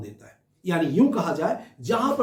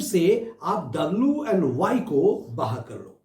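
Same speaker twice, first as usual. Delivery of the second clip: slow emphasis; much slower than usual. Shown as a demonstration. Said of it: "teacher stresses letters W, Y".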